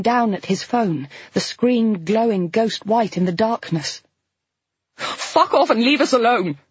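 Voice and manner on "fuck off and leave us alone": a loud, high scream at the intruder; terrified shout, louder and higher. Acted. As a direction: hurl it at him in panic. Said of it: "Flo screamed".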